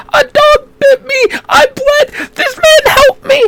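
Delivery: put-on very high wailing kid voice; tearful little boy explaining to his mom; hammed-up squeaky sobbing kid; crying child. Hurt child answers his mother.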